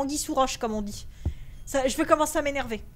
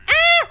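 terrified scream; 14 semitones higher, 12 dB louder than usual. Real, not acted. Jump scare in horror game.

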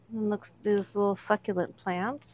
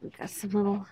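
whispered aside; 6 dB quieter, much faster than usual. Real, not acted. Browsing a quiet thrift shelf, hushed whisper. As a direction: murmur it as if not to disturb anyone.

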